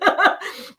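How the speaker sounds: quick voiceless laugh, high; real laugh; breathy high laugh. Real, not acted. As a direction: let a short airy laugh slip. Laughing at own story.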